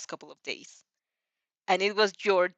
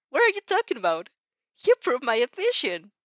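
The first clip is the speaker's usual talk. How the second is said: higher, louder protest; bewildered outburst; indignant surprise; 5 semitones higher, 6 dB louder than usual. A narrator's reading. Athena answering the worried Izuku, startled.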